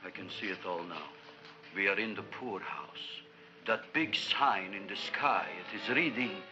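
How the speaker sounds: low, gloomy prophecy; sunk, despairing vision; doom-laden. Acted. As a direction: foretell ruin in a low voice. Doom vision mocking earlier rich vision.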